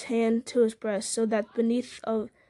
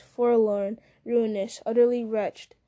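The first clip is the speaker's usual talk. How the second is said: ordinary delivery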